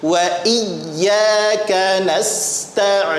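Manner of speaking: very slow, drawn-out recitation; slow model reading. Shown as a demonstration. Teacher finishes the verse for students, slow and measured. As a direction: hold each long syllable to the end. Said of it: "kita baca".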